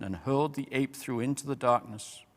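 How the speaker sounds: ordinary delivery, nothing marked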